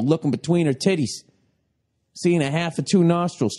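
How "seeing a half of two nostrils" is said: usual speaking voice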